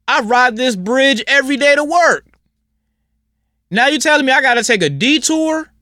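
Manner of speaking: mock whiny commuter. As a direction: whine like a selfish driver stuck with a detour. Mocking selfish commuters' complaints.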